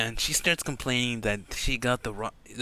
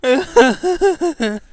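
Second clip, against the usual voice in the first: high squeal; 14 dB louder than usual. Real, not acted. Speaker laughs, stressed.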